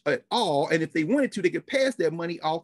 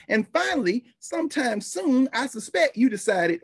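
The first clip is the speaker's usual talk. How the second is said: ordinary talk, nothing marked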